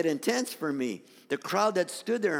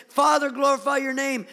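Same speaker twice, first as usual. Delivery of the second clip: loud, raised call to the Father; declamatory cry; 6 semitones higher, 7 dB louder than usual. Acted. Preacher retells Jesus praying to the Father, loud cry. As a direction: proclaim it up to heaven. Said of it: "the story goes like this".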